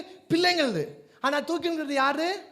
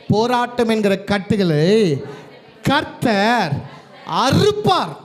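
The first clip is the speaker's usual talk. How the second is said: booming, slow chant-like proclamation for a congregation; full-force preaching declaration; 3 semitones lower, 8 dB louder, slower than usual. Real, not acted. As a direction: declare each phrase loudly, dragging the endings. Leads congregation in loud declaration. (சபையை முழங்க வைக்கும் உரத்த பிரசங்க அறிக்கை)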